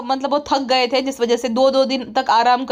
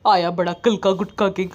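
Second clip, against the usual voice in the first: lowered mocking sneer; lightly voiced sneering wife; 6 semitones lower than usual. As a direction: lower scornful taunt. Wife sneering at the brother-in-law.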